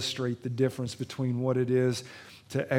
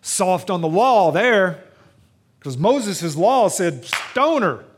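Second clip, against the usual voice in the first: mock objector; 6 semitones higher, 9 dB louder, slower than usual. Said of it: "some people might say".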